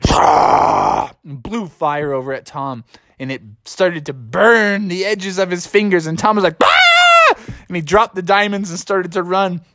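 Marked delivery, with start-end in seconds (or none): 0.1-1.1 low, rough dragon exhale; rasping monster breath; fire-breathing roar. Acted. 6.6-7.3 high, loud yelp of pain; piercing cartoon scream; burnt scream. Tom's fingers burn.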